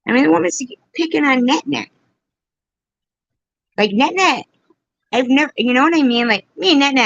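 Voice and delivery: heated and fast. Real, not acted. Defending friend, heated.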